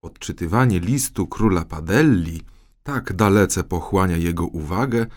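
ordinary delivery, nothing marked

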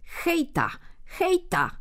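put-on a tiny boy calling high to his horse. A narrator's reading. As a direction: call out to the horse from its ear. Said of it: "mały wołał".

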